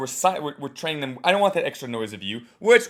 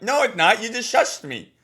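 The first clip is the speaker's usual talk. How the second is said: higher, indignant retort; mock offended crowd; 7 semitones higher, 4 dB louder than usual. Coach voicing the audience, mildly offended. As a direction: answer back, put out. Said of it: "It's like".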